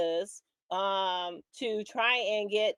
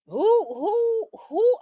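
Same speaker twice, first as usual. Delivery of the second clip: high incredulous cry of who; outraged who; 11 semitones higher, 8 dB louder, much slower than usual. Real, not acted. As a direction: voice jumps up in disbelief. Outraged rhetorical question.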